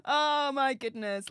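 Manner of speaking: ordinary talk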